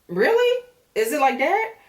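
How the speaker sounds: disbelief, calling him out; incredulous. Real, not acted. Host calling out Aaron for his moves, incredulous.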